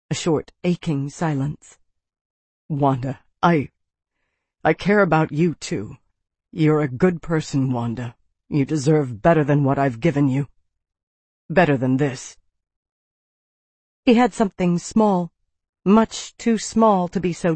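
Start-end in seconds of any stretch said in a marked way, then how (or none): 2.7-12.4 low, halting, heartfelt man's part; a hint of a man confessing he cares; lightly voiced Jared, tender. A narrator's reading. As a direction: say it low and earnestly, sinking on the name.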